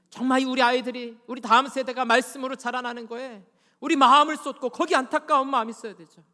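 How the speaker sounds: high, forceful plea; impassioned preaching. Real, not acted. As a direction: press the appeal on the congregation at full force.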